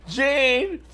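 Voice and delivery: words pushed out through laughter, pitch up; can barely get it out for laughing. Real, not acted. Laughing as she answers the caller Jane.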